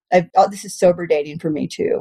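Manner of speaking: normal talking voice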